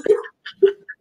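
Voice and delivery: breathy laugh through tears; embarrassed tearful laugh; teary laugh. Real, not acted. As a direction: laugh off the emotion.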